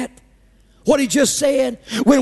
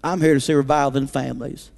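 quiet prayer; 9 semitones lower than usual. Pretend.

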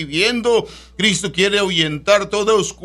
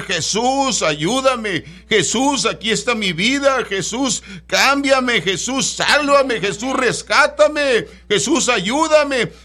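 pleading prayer; 5 semitones higher than usual. Shown as a demonstration. Preacher models a prayer for radio listeners, slow pleading cry. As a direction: call out for rescue, each name stretched. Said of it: "levantar tu voz y decir".

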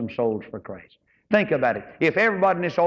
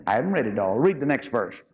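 normal talking voice

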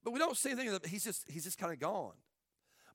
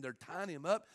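usual speaking voice

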